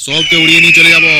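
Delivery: booming, menacing boast; a gangster boss shouting a threat; loud threat. Acted. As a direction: throw the threat out loud to scare him.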